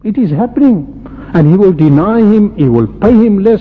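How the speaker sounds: normal talking voice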